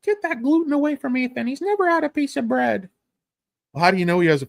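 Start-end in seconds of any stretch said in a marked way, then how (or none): 0.0-2.9 high-pitched parody of a panicky gluten mom; mock fussy mom. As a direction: squeal it like an overprotective mother. Quoting the gluten-free mom.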